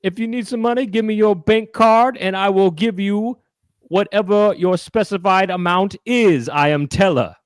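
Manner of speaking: hustle the crowd for bank cards; hammed-up con-man showman; mock Teller. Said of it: "Go ahead".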